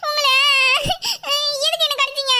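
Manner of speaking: crying. Acted. Girl accusing her lover of biting, crying. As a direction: wail the accusation through tears. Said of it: "எதுக்கு அழுவுற".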